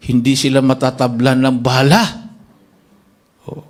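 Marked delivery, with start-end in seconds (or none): none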